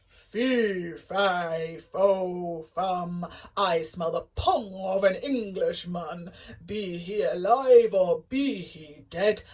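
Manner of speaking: loud booming giant roar. A narrator's reading. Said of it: "roared the giant".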